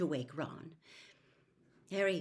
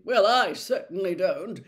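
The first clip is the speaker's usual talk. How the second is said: much louder, prim and self-righteous; sanctimonious; 12 dB louder than usual. A narrator's reading. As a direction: look down your nose at them. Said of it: "said Percy sanctimoniously".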